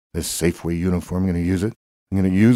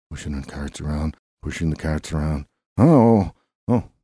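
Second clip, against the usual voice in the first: usual speaking voice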